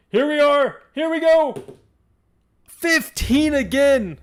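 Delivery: high, loud dice-roll announcement; excited shouting. Real, not acted. Host calls the player's roll, excited high shout. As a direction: shout it out high, drawing out the result.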